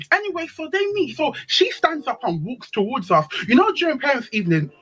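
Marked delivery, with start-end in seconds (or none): none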